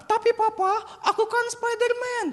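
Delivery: put-on very high, protesting little boy; small child's voice. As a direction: squeak it like a toddler arguing with dad. Voices the small son answering back.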